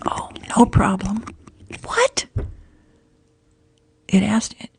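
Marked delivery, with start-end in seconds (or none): 1.8-2.3 sudden high what, much higher pitch; startled what. Real, not acted. Streamer caught off guard by her phone, with a startled high what. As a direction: yelp it in genuine surprise.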